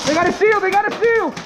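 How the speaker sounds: high, urgent warning yell; alarmed shout. Real, not acted. Alarm at enemy shield.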